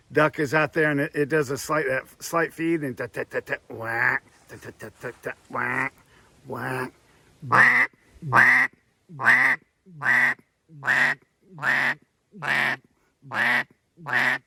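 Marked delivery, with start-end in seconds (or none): 2.8-6.9 soft, low, easy quacking mouth call; gentle imitated duck quacks. Shown as a demonstration. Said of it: "Now you hear how soft and easy that was?". 7.5-14.4 imitated duck quacks. Shown as a demonstration. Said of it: "Now you hear how soft and easy that was?".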